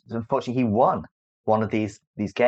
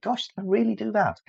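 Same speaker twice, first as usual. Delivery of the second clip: mock astonishment; 13 semitones higher than usual. Surprised at own past deeds.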